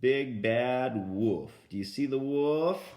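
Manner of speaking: slow, emphatic storybook reading; reading to a toddler. Acted. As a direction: read each word clearly for small children. Reading to grandkids.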